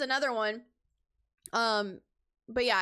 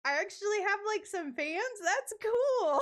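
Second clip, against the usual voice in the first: higher, amazed and delighted; pleased surprise; 9 semitones higher than usual. Retold or imitated. Cosplayer reacting to meeting fans, pleased surprise. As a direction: sound thrilled to have fans. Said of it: "I was like".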